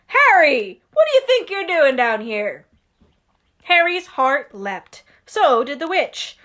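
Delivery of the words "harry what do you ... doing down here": loud, stern call across the alley; Hagrid calling out. Acted. Hagrid catching Harry in the wrong alley, loud and stern. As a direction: bellow at him like a worried giant.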